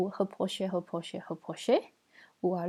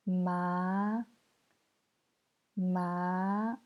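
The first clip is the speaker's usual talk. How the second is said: "Ma" rising slowly to the end; second tone. Shown as a demonstration. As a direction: let each "Ma" climb from mid pitch. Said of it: "C'est le deuxième ton".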